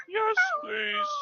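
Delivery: very high squeal of yes please; high puppet voice. Pretend. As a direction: answer as an eager little puppet.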